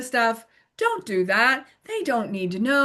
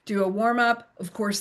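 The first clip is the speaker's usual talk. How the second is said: usual speaking voice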